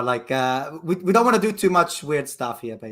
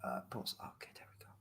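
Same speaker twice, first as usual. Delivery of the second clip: hushed whisper so the call can't hear; 23 dB quieter than usual. Real, not acted. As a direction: whisper it under the breath.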